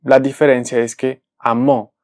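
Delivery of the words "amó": amó with mó as the strong syllable; a-MÓ, stressed at the end; stress on last syllable. Shown as a demonstration. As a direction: lean on the final ó in amó. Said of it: "en la última sílaba".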